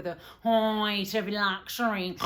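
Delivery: loud, put-on sneering imitation; mock the masses' idea of luxury, loudly. Mocking cruise passengers' taste.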